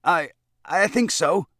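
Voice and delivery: high, loud stammering wail; boy blubbering fake fear to the lobbyist. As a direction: blubber it out in feigned tears. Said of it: "blubbered Freckles".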